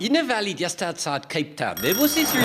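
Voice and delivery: put-on Welsh accent. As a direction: ham up a Welsh lilt. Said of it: "Now that's Welsh, that's Welsh".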